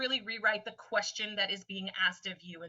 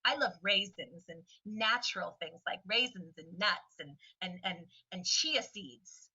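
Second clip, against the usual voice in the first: laid-back California guy; 4 semitones lower, 4 dB quieter than usual. Retold or imitated. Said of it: "Go, Tim, California guy".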